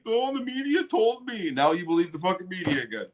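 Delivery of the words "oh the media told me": high, whining mock plea; mocking whiny voice. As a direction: mimic a helpless whiner, pitched up. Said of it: "You're bitching and complaining".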